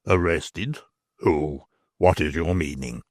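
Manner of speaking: a worried man swearing under his breath; Stracey's voice. Acted. Stracey asks.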